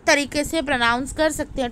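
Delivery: ordinary talk, nothing marked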